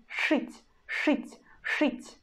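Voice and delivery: шить whispered, hard ш and ы; whispered demo. Shown as a demonstration. Teacher whispers шить for students to copy. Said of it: "pronounce Ш in a hard way".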